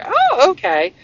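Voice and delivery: high, loud startled "oh" falling to acceptance; surprised okay. Retold or imitated. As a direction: suddenly caught off guard, then giving in. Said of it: "I'm like".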